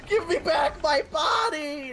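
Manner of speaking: high, slow anguished yell; hammed-up cry for his stolen body; mock desperate shout. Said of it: "where he's like".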